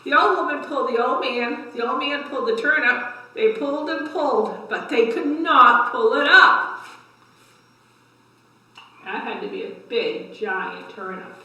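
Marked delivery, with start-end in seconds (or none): 0.0-6.9 lively, rising read-aloud for little ones; story time voice for a class; reading to children. A narrator's reading. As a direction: build the effort with each pull. Reads cumulative tale to kids.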